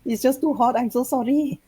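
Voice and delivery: high, loud flustered apology; squeaky sorry excuse; high apologetic voice. Acted. Janaya excuses himself.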